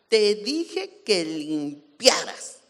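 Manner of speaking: slow, emphatic scolding mom; imitating mom's stern telling-off; angry, bossy mother. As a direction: scold like a fed-up, forceful mother. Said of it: "enojona y enérgica. Ella decía".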